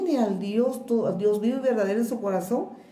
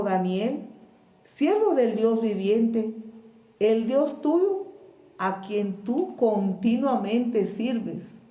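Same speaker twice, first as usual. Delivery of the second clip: ordinary talk, nothing marked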